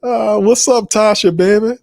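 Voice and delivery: high, playful hello between laughs; greeting a viewer with a high playful call. Real, not acted. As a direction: tease her warmly with a high voice.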